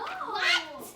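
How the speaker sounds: high, loud shocked what; startled what; surprised what. Real, not acted. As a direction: react in disbelief. Kid reacts to absurd idea.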